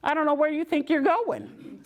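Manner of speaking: high, mock-scolding aches; scolding ankles. Her aching ankles scolding her to sit down, high and nagging. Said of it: "my ankles are like".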